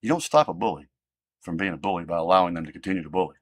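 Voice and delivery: brisk, offhand repeat of the rule; quick restatement. Real, not acted. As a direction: toss the line off quickly as a reminder. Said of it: "I don't care".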